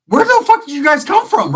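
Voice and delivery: high, loud shocked yell; shocked outburst. Retold or imitated. Comic yelling in shock at the bulldogs arriving. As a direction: yell it in disbelief. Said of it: "And I'm like".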